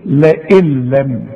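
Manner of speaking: لئن لم said slowly, the nun dropped into the lam with no ghunna; slow demo of لئن لم with full merging; merged recitation. Shown as a demonstration. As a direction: glide from لئن into لم without sounding the nun. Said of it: "هنقول إيه؟".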